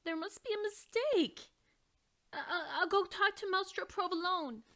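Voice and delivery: high flustered protest; dismayed. Acted. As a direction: stammer in disbelief. Dolores upset, rejected.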